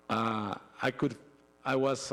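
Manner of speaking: ordinary talk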